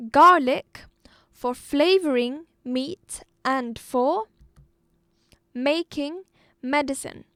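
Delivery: loud, deliberate dictation-style reading; reading aloud. Shown as a demonstration. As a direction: read out in measured chunks with pauses. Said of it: "So, I'll read it again".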